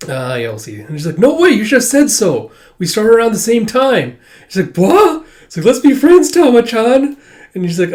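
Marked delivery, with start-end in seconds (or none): 1.2-4.2 high, loud and delighted; excited. Retold or imitated. Akane happily telling a fellow newcomer, excited and loud. Akane thrilled to meet peer. 4.8-5.2 a sudden high, loud yelp; startled. Retold or imitated. Akane caught off guard by the news, a high yelp. 5.7-7.2 high and loud with a bright rise; eager. Retold or imitated. Akane eagerly inviting Tama to be friends, high and loud.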